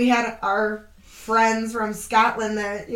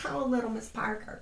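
put-on Southern accent; 3 semitones higher, 6 dB quieter than usual. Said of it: "We love accents in general".

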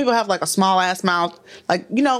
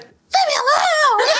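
high, drawn-out wailing shout; mock crying yell; much slower than usual. Aunt imitates her nephew crying, high mock wail. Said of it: "when he cries, he still cries".

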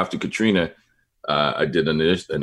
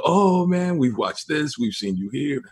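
neighbors' voice; 9 semitones higher than usual. Retold or imitated. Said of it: "they go".

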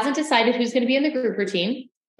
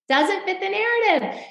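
high, pointed refrain; pointed repeat; 10 semitones higher than usual. Real, not acted.

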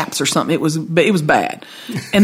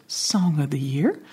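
slow, softer imitated answer; understated reveal; 3 semitones lower, 8 dB quieter, slower than usual. Songwriter telling her which award he won, imitated low-key reply. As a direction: let it out quietly and slowly.

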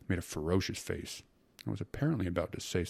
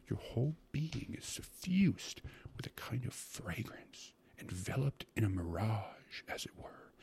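slow, savoring whisper; hushed, drawn-out rapture; scarcely audible whisper; 6 semitones higher, 6 dB quieter, slower than usual. A narrator's reading. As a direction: whisper it like a delicious secret. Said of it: "he went on in a scarcely audible whisper".

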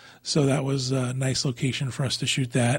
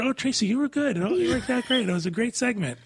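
imitating an overly sweet pep talk, higher; hammed-up kind reassurance to the guest; mock encouragement; 8 semitones higher, faster than usual. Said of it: "we tried to encourage her".

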